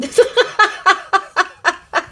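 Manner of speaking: high-pitched burst of laughter. Real, not acted. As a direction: laugh at her own stumble. Rit de son bafouillage. (éclat de rire aigu)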